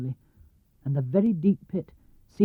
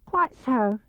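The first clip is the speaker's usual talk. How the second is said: higher Piglet voice forcing bravery; braver Piglet; 6 semitones higher than usual. Acted. Piglet talking himself into courage, forced brave squeak. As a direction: puff up the tiny voice. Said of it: "still more bravely".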